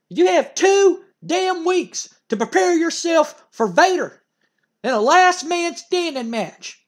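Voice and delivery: a manager losing it at the wrestler; furious manager rant. Retold or imitated. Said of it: "And Jim Cornette goes".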